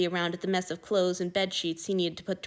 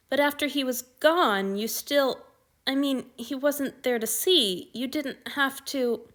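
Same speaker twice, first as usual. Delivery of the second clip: put-on hesitant young man; 6 semitones higher, slower than usual. Anthony hesitant, hurt question.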